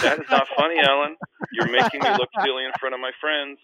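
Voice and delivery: high, loud whiny complaint; indignant protest. Retold or imitated. Pranked rookie whines to teammate, high indignant protest. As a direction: play the wounded, embarrassed friend. Embarrassed by prank before friends.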